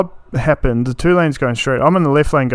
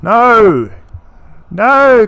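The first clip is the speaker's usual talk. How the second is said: loud, high exasperated "No"; real exasperated protest; emphatic no; 9 semitones higher, 6 dB louder than usual. Real, not acted. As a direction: shut it down hard. Reacting against the phrasing.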